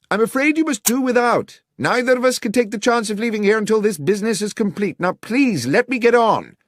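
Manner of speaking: high, loud call. A narrator's reading. Holmes calls from the basement to dismiss Watson, loud. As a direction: shout it dismissively through the door.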